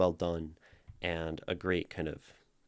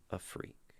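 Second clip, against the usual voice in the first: breathy whispered aside; hushed conspiratorial whisper; 11 dB quieter than usual. Acted. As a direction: drop to a secret whisper.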